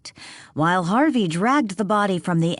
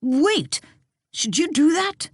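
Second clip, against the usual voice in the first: high, urgent alarm; startled; 11 semitones higher than usual. A narrator's reading. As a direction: stop him in sudden alarm. Bart startled, stopping Harvey.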